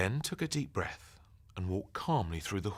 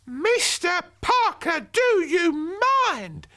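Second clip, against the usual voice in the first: high, loud, slow mock-outraged granny; hammed-up shocked old lady; mock horror; 10 dB louder, much slower than usual. A narrator's reading. Grandmother feigning shock at the intruder, slow and high. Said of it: "in mock horror".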